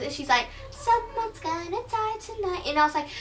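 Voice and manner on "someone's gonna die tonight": very high, sing-song doll voice; Barbie. Acted. Possessed phone threatens the storyteller, high doll voice. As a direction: pipe it up high like a creepy doll. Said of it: "it was Barbie's voice".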